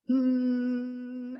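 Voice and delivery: long, steady closed-lip hum; humming demo. Shown as a demonstration. Coach showing people how to hum, sustained humming. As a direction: sustain an easy hum on one pitch. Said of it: "just try it with me".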